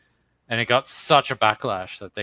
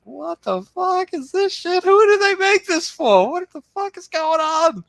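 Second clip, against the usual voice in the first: much higher, louder, slow outraged yell; exasperated shout; 9 dB louder, slower than usual. Retold or imitated. Reacting to the show, he screams in high outrage. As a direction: shriek your disbelief at the screen. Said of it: "I'm like".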